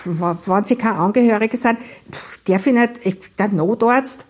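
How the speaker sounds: flustered false starts and restarts; halting, searching stammer. Acted. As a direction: stumble over the words, breaking off mid-phrase. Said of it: "und stammelte".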